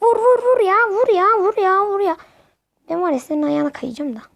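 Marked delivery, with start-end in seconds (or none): 0.0-2.2 excited urging. Real, not acted. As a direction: push him to shoot, rising. Urging player to shoot.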